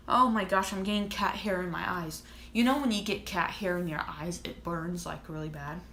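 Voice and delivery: normal talking voice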